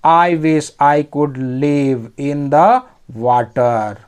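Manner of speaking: very slow, word-by-word English; slow dictation pace; slow reading. Shown as a demonstration. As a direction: read each word out slowly for learners. Teacher reads story line aloud.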